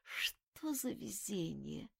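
slow, soft groaning sigh; inner groan. A narrator's reading. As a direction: moan it to yourself, barely voiced. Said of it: "мысленно простонала".

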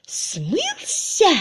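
very high squeal of disbelief; shrieked. A narrator's reading. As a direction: shriek the question. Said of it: "взвизгнула мисс Твиттертон".

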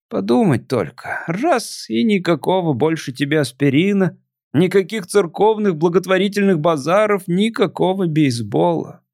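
put-on a melancholy drunk visitor; tipsy alien voice.

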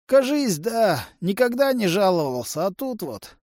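put-on high, strained old patient's voice; ailing uncle. A narrator's reading. Sick uncle answering about his heart, strained. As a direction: complain high, tailing off at the end.

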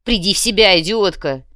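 loud, sharp rebuke; loud, insulting command; angry scolding. A narrator's reading. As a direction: snap her out of it harshly. Companion scolds girl in shock.

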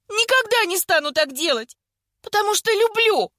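high, heated declaration rising at the end; passionate outburst. A narrator's reading. Vika confessing love while arguing with Nonna, passionate outburst. Vika's outburst continues after crying out.

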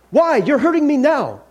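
high, loud outcry; acted pained protest. Ghost hurt by the angel, pained and alarmed. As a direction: cry out as if being burned.

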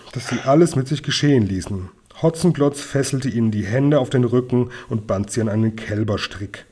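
ordinary delivery

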